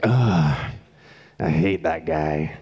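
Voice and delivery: put-on low, slow, fed-up groan of an annoyed older sibling. Oldest siblings grumbling about the tattler, low and slow, mock annoyed. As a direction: drag it out like the big sister who got tattled on. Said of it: "all the oldest brothers and sisters in the room are like".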